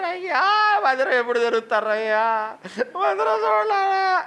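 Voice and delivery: high, hammed-up crying plea, drawn out at the end; mock wailing. Preacher mimics members wailing on the phone for the church, hammed-up sobbing. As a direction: imitate a believer sobbing to reopen the church. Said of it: "అని తెగ ఏడిచారు".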